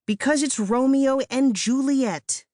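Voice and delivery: high, slow exasperated screech at her sibling. A narrator's reading. Sister screeching at a slow sibling, high and exasperated. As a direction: spell it out, fed up and shrill. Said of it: "Katie screeched at me".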